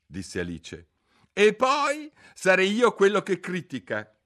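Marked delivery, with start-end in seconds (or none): 1.3-4.1 high, loud gloating exclamation; triumphant. A narrator's reading. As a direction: crow over a point scored. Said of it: "esclamò il cappellaio trionfante".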